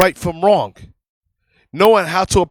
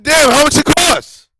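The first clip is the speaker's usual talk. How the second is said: higher, louder annoyed outburst; husband cutting off a long story, loudly annoyed; exasperated retort; 9 semitones higher, 6 dB louder than usual. Retold or imitated. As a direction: fire it back at the wife, fed up. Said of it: "I said".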